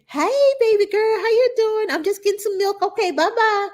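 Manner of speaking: high, sing-song cheerful mom voice; hammed-up carefree parent tossing a greeting; breezy parent. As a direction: chirp it carelessly, like popping in for milk. Parent breezing through nursery.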